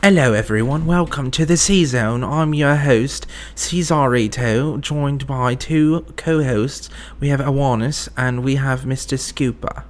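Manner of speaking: put-on British host voice; British accent. Host introducing the co-hosts, mock British accent. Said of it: "You can't be doing a British voice".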